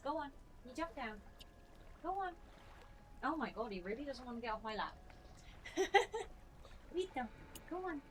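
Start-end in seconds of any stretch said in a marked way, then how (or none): 0.0-2.3 pet talk. Real, not acted. Urging the dog off her lap. 6.9-8.0 pet talk. Real, not acted. Urging the dog off her lap.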